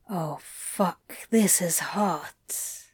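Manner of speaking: soft, slow, breathy; breathless hush. Acted. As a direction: let it out under your breath. Aroused, overwhelmed.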